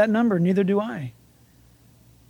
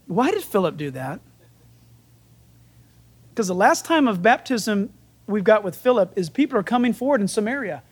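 ordinary talk, nothing marked

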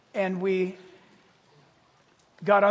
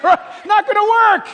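high, loud, slow rejection; stern gatekeeper; 13 semitones higher, 18 dB louder, slower than usual. Retold or imitated. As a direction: shoot it down slowly, loud.

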